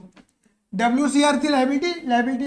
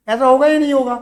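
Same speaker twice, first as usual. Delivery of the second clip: usual speaking voice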